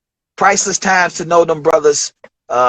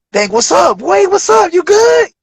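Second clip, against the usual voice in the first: excited greeting; 14 semitones higher, 3 dB louder than usual. Retold or imitated. Common greets the newcomer at the studio, hyped and high. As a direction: voice climbs way higher into a hyped greeting.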